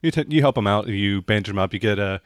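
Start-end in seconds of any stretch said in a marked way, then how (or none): none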